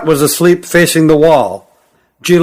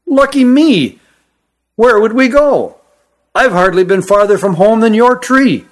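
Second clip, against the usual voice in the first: high-pitched, protesting lad; reluctant young cousin; younger cousin, reluctant; 7 semitones higher than usual. A narrator's reading. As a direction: object in a much higher young voice.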